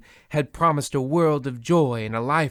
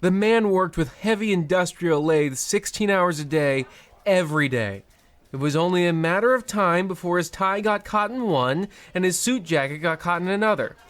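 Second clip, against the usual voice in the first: higher, brisk, callous banker; smug officious banker, pitched up; bank manager; 6 semitones higher than usual. Acted. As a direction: rattle off the cruelty like routine paperwork.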